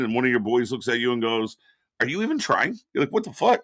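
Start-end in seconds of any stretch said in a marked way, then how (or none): 2.0-2.8 high teasing question; mock a buddy who doubts you; mocking friend. 3.1-3.6 high shocked outburst; indignant. Retold or imitated. Indignant reaction.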